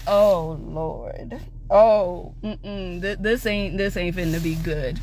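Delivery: low, resigned foreboding; dreading aside. Retold or imitated. Siblings seeing dad about to lose it, dreading. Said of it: "we're like".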